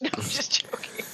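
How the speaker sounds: half-whispered through a laugh. Real, not acted. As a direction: breathe it out laughing, taking it back. Takes back a mock insult.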